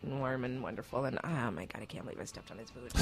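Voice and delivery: hushed embarrassed whisper; sheepish whispered confession. Real, not acted. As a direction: breathe it out in disbelief.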